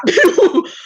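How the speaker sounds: high burst of laughter; cracking up. Real, not acted. Host breaking up mid-impression, high laughter. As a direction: explode into giggles mid-sentence.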